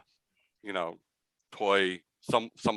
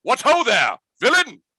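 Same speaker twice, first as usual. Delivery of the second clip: high, loud, dashing challenge of a tiny musketeer; swashbuckling mouse leader; 14 semitones higher, 9 dB louder than usual. Acted. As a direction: hail the intruder like a theatrical swordsman. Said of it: "He goes".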